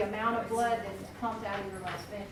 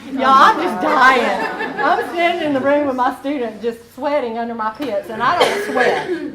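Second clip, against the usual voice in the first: loud laughing retelling, words broken by laughter; can barely get it out for laughing; laughing while telling; 4 semitones higher, 17 dB louder than usual. Real, not acted. Teacher laughing to her class about the rude surgeon.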